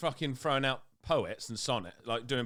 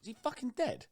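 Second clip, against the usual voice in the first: mock alarm; 13 semitones higher than usual. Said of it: "And I'm like".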